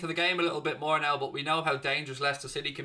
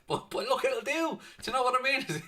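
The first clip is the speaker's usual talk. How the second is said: high laughing voice; 10 semitones higher than usual. Real, not acted. Laughing off bad impression.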